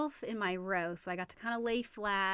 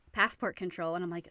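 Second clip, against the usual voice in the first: ordinary talk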